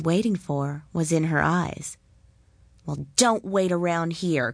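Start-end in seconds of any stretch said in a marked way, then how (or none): none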